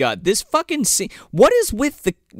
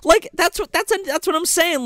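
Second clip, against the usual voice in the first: much higher, incredulous squeal; high outraged rant about the attorney; exasperated rant. Real, not acted. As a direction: rant in disbelief, pitched way up. Ranting about the attorney's choice.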